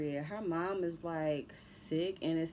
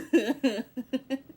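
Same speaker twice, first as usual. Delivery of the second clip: a loud, real burst of laughter; 8 semitones higher, 10 dB louder than usual. Real, not acted. Viewer laughing out loud at the show. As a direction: crack up at the scene.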